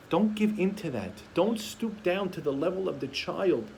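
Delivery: normal talking voice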